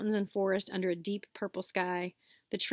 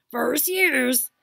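loud, higher booming call; 5 semitones higher, 9 dB louder than usual. Acted. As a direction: summon across the platform. Hagrid calling students.

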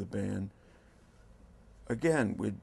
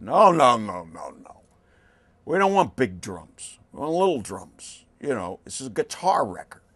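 imitating a loud, firm refusal, louder; insistent refusal; 10 dB louder than usual. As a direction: bark out the no's, then insist. Tom's flat refusal retold.

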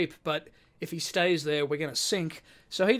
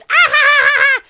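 put-on loud, very high-pitched child's cackle; mock squeal of laughter, far above your own voice; imitated baby laughter; 17 dB louder than usual. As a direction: shriek with laughter like a delighted little kid. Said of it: "And the kid goes".

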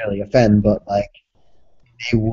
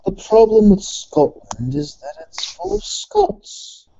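slow, mock English accent; bad English accent; 9 semitones higher, slower than usual. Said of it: "worst fucking Scottish accent".

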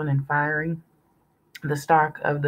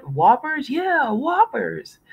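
high, slow cheering; excited kids; 10 semitones higher, 3 dB louder, slower than usual. A narrator's reading. Boys cheering at the bag of Whoppers. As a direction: cheer over the burgers.

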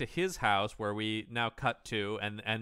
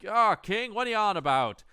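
high, louder chiding; hammed-up dog owner; hunter to dog; 9 semitones higher, 5 dB louder than usual. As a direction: talk to the dog like a fond owner. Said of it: "he's like".

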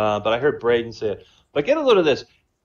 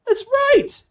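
very high approving "That's right."; high-pitched Saint Peter delighted at last; 5 dB louder than usual. Retold or imitated.

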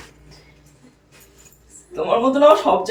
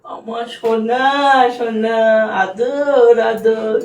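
tender cooing; much slower than usual. Acted. Cooing affection.

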